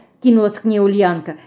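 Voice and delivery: ordinary delivery, nothing marked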